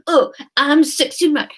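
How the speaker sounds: mock-seductive pursed-lip voice; sexy man voice. Shown as a demonstration. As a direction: pout and purr like a parody heartthrob. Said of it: "doing sexy man voice with pursed lips".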